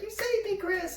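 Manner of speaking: very high, adoring falsetto; put-on high-pitched gushing; Jimmy. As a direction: imitate a grateful kid in a squeaky voice. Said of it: "he talked like this".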